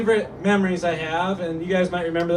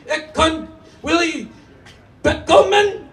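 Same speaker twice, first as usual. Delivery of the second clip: much higher, slow, then shrill hammed-up German; mock German Hitler; 8 semitones higher, slower than usual. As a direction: ham up a ranting Hitler in German. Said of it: "Hitler ... he goes".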